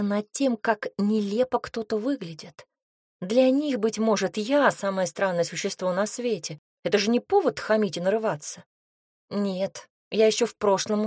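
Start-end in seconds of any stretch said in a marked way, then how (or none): none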